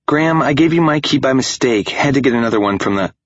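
bright, high, quick chatter; breezy and high, rushing on; surprised chatter. Acted. Bella bursts in cheerfully.

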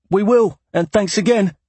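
called out high and loud; calling out. A narrator's reading. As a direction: call it out across the shop. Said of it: "Lusam called out".

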